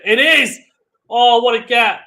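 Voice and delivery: real burst of amazement, high and loud; excited shout. Real, not acted. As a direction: erupt as the impossible shot lands. Shot unexpectedly lands, commentator erupts.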